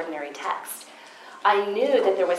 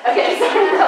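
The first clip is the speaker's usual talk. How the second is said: laughing apology; 13 semitones higher, 7 dB louder than usual. Real, not acted. Laughs at her own tears.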